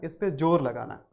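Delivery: ordinary talk, nothing marked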